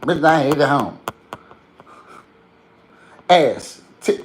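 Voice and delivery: hyped Memphis rapper voice. Retold or imitated. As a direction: belt it like the rapper. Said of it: "She's like this".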